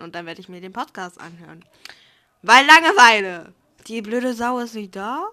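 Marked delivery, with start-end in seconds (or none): 2.4-3.5 loud. Real, not acted. Punchline shouted.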